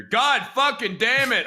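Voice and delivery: high, very loud, drawn-out curse; imitated yelling. Big coworker heard all the way from the host stand, yelling. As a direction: bellow it slowly as an impression. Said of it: "he'd be yelling".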